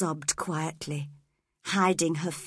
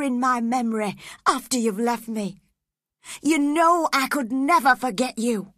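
a lightly voiced lover, growing louder and raised in pitch; pained Heathcliff; 6 semitones higher, 6 dB louder than usual. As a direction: protest with rising passion. Heathcliff answers her.

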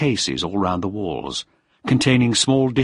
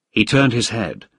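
plain reading voice

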